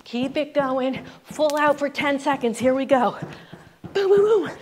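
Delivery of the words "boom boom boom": loud, high "boom" bursts; high-energy booms. Real, not acted. Coach launching the final ten seconds, loud high booms. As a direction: punch out booms to drive the sprint.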